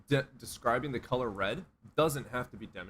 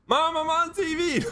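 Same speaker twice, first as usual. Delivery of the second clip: put-on much higher, louder childish shout; excited kid yell; 7 dB louder than usual. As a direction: shout to mom like a thrilled kid.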